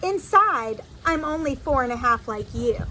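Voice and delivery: ordinary delivery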